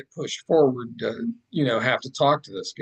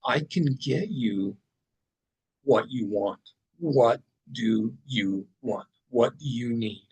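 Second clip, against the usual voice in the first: serious. Retold or imitated. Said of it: "he looks at me, like, real seriously".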